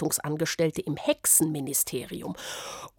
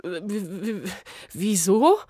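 stuttering. Acted. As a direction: stammer in fright. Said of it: "stotterte Smyrna". (Smyrna stottert ängstlich vor der Beamtin)

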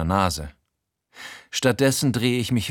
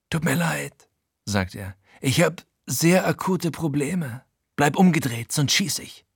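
Frankie, etwas höher, gepresst drohend; leicht höherer Frankie, drohend; 5 semitones higher than usual. Acted. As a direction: droh ihm von hinten, ohne laut zu werden. Frankie entschuldigt sich, droht. (Frankie bedroht den Erzähler mit der Pistole im Rücken, gepresst)

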